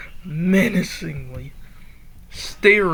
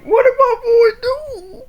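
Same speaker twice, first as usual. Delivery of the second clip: high, loud, laughing outcry; laughing disbelief; 5 dB louder than usual. Real, not acted. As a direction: cry out through laughter for your favourite. Reader laughing at favourite character.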